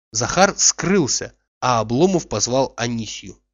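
plain reading voice

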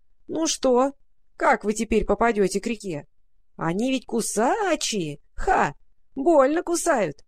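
high, creaking, gloating animal voice; creaky rat-crow voice. A narrator's reading. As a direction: screech it out like a spiteful rat-crow. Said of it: "донёсся скрипучий голос".

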